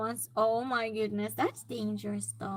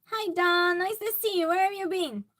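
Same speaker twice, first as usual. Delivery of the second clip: higher, louder delighted hello; excited greeting; 6 semitones higher, 6 dB louder than usual. Real, not acted. Streamer welcomes back a missing viewer, excited greeting. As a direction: call out brightly to a long-missed friend.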